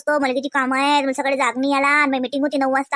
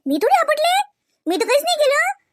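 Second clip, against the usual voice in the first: defensive denial; 13 semitones higher, 3 dB louder than usual. Acted. Rashmi denies, high and defensive.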